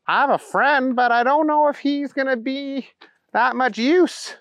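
high, dim character voice; doubtful Booger. A narrator's reading. Booger doubting his friend can help, high-voiced. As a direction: stay high and drag the ending.

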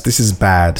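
ordinary talk, nothing marked

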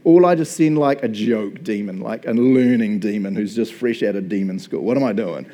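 loud, swaggering devil voice; cocky Satan. Retold or imitated. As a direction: play a smug bro devil sizing up his target. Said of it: "Satan's standing and he's like".

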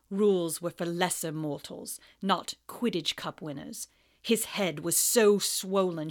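low, cold, mocking teacher; sneering Snape. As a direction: drip with contempt. Snape malicious.

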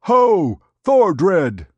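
a very high, loud rallying cry; narrator's mob bursting through the doors, roaring; crowd roaring. A narrator's reading. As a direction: yell it like a mob storming in. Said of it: "they roared".

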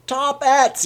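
suddenly delighted by the word; brightened. A narrator's reading. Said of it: "The old man brightened suddenly".

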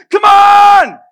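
very high, very loud raging yell; furious shout. Retold or imitated. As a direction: roar at the other driver. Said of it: "And I'm like".

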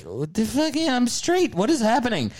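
high, startled, half-panicked outburst; shocked disbelief. Retold or imitated. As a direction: act stunned at your own reaction. Shocked inner reaction, quoted.

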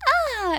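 put-on very high, girlish copycat "ah"; mock girl-voice cry. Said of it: "in a girl voice".

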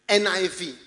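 ordinary talk, nothing marked

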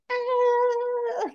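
high, short mock scream; quick high-pitched mock shriek; retold scream. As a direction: yelp up high as if grabbed. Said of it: "she's like".

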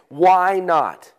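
ordinary delivery

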